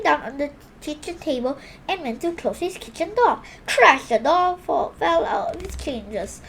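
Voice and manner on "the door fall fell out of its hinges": ordinary delivery, nothing marked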